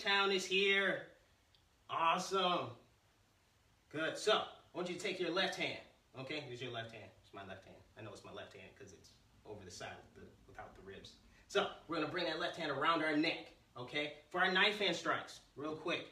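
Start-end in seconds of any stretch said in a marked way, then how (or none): 6.2-11.1 much softer, lower mumbling aside; quiet aside. Real, not acted. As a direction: drop into a muttered aside. Aside while turning.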